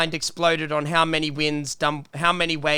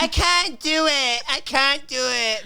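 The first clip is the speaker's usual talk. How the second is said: higher and slow, a whiny mock sob; mock crying Malfoy; 7 semitones higher, slower than usual. Friend mocking Malfoy on the tower, slow whiny mock cry. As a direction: whimper it like a scared schoolboy, hammed-up. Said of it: "Malfoy's like".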